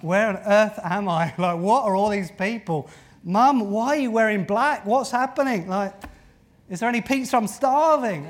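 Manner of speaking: high, loud bewildered chatter; preacher voicing the confused risen son; risen young man. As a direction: play the dazed boy waking up hungry. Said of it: "what was he saying".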